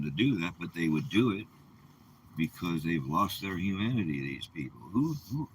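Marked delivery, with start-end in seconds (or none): none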